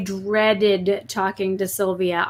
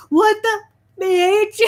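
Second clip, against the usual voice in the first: higher, louder mock-angry cussing; retold mad outburst; 11 semitones higher, 4 dB louder, slower than usual. Creator retelling cussing in a video, mad outburst. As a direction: act out losing your temper, pitched up. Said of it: "I was so mad".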